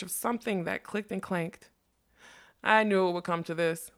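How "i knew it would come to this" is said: usual speaking voice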